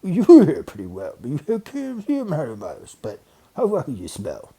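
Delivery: a hint of a taunting bear; challenging bear. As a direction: turn the tables.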